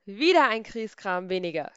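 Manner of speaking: a rainbow pleased with itself; gloating grin. A narrator's reading. Rainbow gloats after flinging off Herr Grieskram, smug. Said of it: "grinste der Regenbogen".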